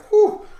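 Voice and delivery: high, loud exhaled whew. Retold or imitated. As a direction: blow it out in shock. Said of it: "I was like".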